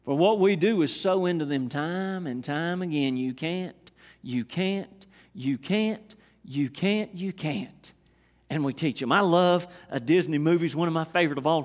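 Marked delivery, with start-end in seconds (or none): 3.1-7.9 quiet, fading chant of you can't; mock discouraging parent, hushed; soft repeated discouragement. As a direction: let each you can't sink lower and softer. Voicing discouraging adults to children.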